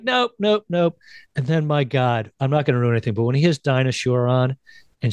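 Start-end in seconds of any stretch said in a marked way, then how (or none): 0.0-1.0 higher bratty repeated refusal; imitating Pee-wee. Pee-wee refusing like a kid, higher bratty mimicry. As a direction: bounce through each nope like a kid. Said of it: "he's just like".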